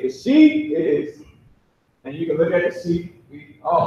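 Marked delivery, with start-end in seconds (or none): 0.0-1.2 "The she is" slow and much higher; Hebrew sound demo. Shown as a demonstration. Teacher asking students to listen to how he says it, slow. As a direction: sound out "she" slowly for the class. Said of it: "listen to how I say it".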